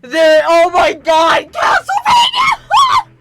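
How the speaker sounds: real shrieking joy at full volume; excited screaming. Real, not acted. Streamer screaming at the Castlevania reveal, ecstatic. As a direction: scream with hype, voice cracking high. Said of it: "I get so excited. I tend to fucking yell and scream".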